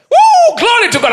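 loud high whoop into praise; whoop, then praise at high pitch; exultant whoop. Retold or imitated. Preacher recalling his boast of riches, exultant whoop.

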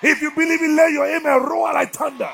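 loud, high preaching cry; full-force preaching. Acted. Preacher roaring at his congregation. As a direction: preach it out over the crowd.

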